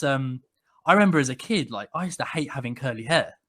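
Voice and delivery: usual speaking voice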